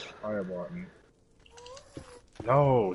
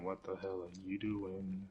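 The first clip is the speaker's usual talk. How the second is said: much softer, lower mock-gentle questioning; hushed scolding; 4 semitones lower, 12 dB quieter than usual. Real, not acted. Gamer quietly scolding the self-harming Enderman. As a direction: murmur as if to a silly pet.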